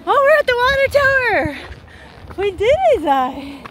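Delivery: high, loud excited shout; triumphant. Real, not acted. Hikers reach the water tower, triumphant. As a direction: shout it out like a victory.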